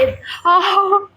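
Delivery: high laugh at her own fumble; burst of laughter. Real, not acted. Vlogger laughing at her own stumble, high burst of laughter. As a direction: laugh it off after tripping on words.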